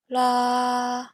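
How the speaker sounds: level mid note on la; medium pitch la. Shown as a demonstration. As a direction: back down to the middle note. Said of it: "locating the medium pitch".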